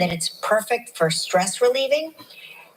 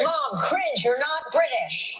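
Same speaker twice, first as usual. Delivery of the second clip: high, drawn-out mock daughter; embarrassed daughter; 6 semitones higher, slower than usual. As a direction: imitate a mortified kid scolding mom. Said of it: "our daughter's embarrassed all the time".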